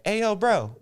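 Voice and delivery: imitating a hyped stranger calling out; playing the Korean American guy saying hi; eager bro voice. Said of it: "he's like".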